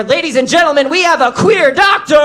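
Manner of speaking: loud, high announcer cheer; loud high cheering, hyping up the queer doctor; excited announcement. Acted. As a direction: cheer it like a game show host.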